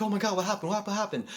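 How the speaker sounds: high, panicked questions; startled girls. Retold or imitated. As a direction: mimic the startled friends. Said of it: "woke up, like".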